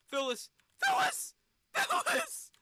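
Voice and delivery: high, loud panicked calls; panicked yelling. Real, not acted. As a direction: scream the pilot's name. Panicking as the capsule falls.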